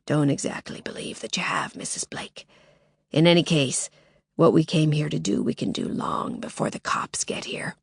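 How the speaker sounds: harsh, threatening whisper; menacing whisper. Acted. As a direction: hiss the threat close. Intruder whispers.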